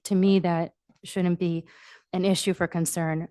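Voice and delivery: ordinary talk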